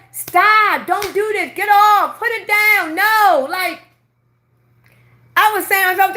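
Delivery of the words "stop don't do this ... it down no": put-on mom scolding the kid. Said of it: "she been telling".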